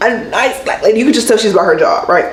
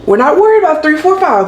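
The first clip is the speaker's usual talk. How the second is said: ordinary talk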